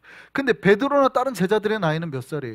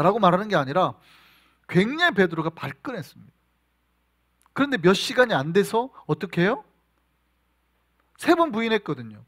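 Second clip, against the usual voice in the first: ordinary delivery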